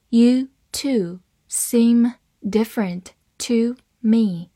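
"You two seem different to me", slow, word by word; slow read-along. Shown as a demonstration. Teacher modelling the seem example for learners, slow word-by-word reading. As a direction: leave clear gaps between the words. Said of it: "好，慢慢来读".

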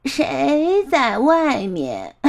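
put-on slow, drawn-out old granny call; frail grandmother. Grandma in bed asks who is at the door, slow and frail. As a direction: call out slowly like an old woman.

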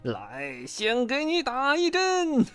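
creepy high-voiced villain, ending in a cackle; sinister nightmare dentist. A narrator's reading. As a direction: toy with the frightened patient. Nightmare dentist threatens Bengbeng.

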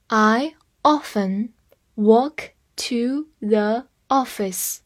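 I often walk to the office, each word drawn out slowly; slow reading. Shown as a demonstration. Teacher reads the example slowly for students. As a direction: read it slowly so learners can follow. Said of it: "我们慢慢来读一遍".

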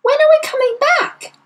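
put-on high, loud child's question; distressed, pleading child voice; anxious cry. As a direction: demand it like an upset little girl. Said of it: "Matilda cried".

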